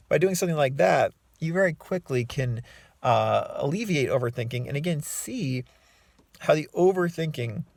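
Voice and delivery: normal talking voice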